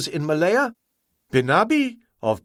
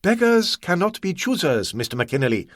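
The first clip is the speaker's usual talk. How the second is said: coldly; 6 semitones higher than usual. Acted. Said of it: "Ah Wong said coldly".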